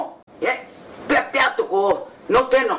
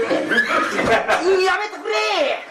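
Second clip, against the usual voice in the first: very slow, much higher strained cry; anguished protest; 8 semitones higher, much slower than usual. Acted. Watching the geisha fondled, he cries out in drawn dismay. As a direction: drag out the plea in dismay.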